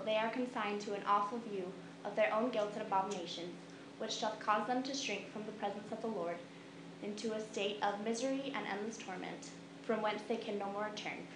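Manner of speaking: usual speaking voice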